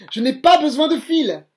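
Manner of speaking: loud, high cry of a showing-off puppet; triumphant shout to the audience; shouting proudly. Acted. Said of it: "crie-t-il".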